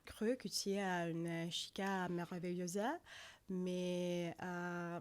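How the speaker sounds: Spanish spoken with a French accent. Shown as a demonstration. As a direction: put on a French accent over halting Spanish. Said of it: "trying to do Spanish with a French accent".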